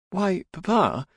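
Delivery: high, guileless daughter voice; high-pitched mock innocence to Papa; feigned innocence. A narrator's reading. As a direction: play the innocent daughter caught out, pitched well up. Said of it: "her guileless expression was being undermined".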